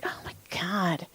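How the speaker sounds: hushed, whispered aside; retold appalled whisper. Masseuse reacts to client's creepy compliment, appalled whisper. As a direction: whisper it as a horrified inner reaction.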